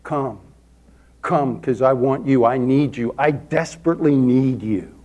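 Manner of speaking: hushed plea to the Holy Spirit; earnest prayer. Retold or imitated. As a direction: pray it low, yearning and unhurried. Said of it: "turn my hands this way and say".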